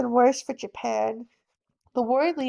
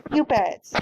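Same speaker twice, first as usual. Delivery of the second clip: normal talking voice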